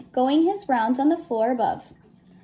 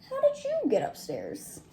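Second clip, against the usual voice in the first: higher, softer kindly voice; gentle watchman; 9 semitones higher, 8 dB quieter than usual. Acted. Watchman finding the bear under the covers, softly amused. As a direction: coax the little bear gently.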